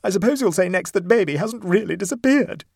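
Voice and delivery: high, loud, indignant wail; temper through grief. A narrator's reading. Mother challenges the neighbour's denial, temper showing through grief. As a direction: let anger show through the distress. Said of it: "temper began to shine out through her grief".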